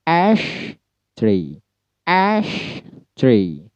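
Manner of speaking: slow syllable-by-syllable demo of Ash tree; stress on ASH, split. Shown as a demonstration. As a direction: separate the syllables, punch ASH. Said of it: "Ash-nya yang ditekan".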